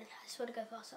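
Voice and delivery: quick whispered aside; whispering. Real, not acted. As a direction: whisper it to yourself. Aside while rushing tour.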